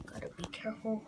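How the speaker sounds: soft whisper, careful. Real, not acted. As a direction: whisper so the birds stay calm. Sneaking around chickens.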